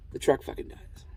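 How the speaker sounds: whispered, as if hiding the curse; whispered swear. Real, not acted. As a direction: drop the swear under your breath in a whisper. Swearing, then apologizes for F-bomb.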